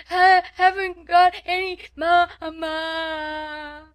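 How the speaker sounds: high, loud, slow bawling; howling small child. A narrator's reading. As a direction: wail the words out, stretching the last into a long cry. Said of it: "Lottie howled tempestuously".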